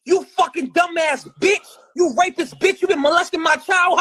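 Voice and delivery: furious high shout; screaming insults in rage; angry yelling. Retold or imitated. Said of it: "my dad loses it".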